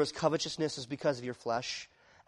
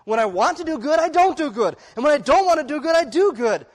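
high and loud, exasperated confession; Paul's frustrated cry; 9 semitones higher, 10 dB louder than usual. Acted. Paul confessing his inner battle to the church, loud, high and exasperated. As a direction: throw up your hands in bewildered frustration, voice up high. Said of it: "He's saying".

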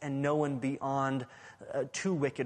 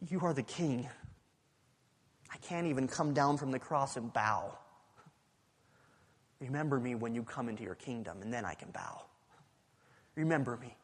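humble plea; 4 semitones lower, 6 dB quieter than usual. Retold or imitated. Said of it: "All he could say was".

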